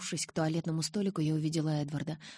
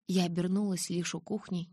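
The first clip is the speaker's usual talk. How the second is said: ordinary delivery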